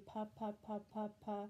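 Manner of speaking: "puh, puh, puh" said evenly and steadily; even, rhythmic "puh" repeats; repeated puh. Shown as a demonstration.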